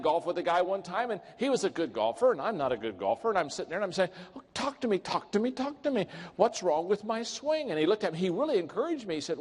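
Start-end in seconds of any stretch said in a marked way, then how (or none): 4.3-7.7 high-pitched eager begging, words repeated; pleading. Retold or imitated. Bad golfer begging his partner for advice, pleading. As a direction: plead for an answer in a higher voice.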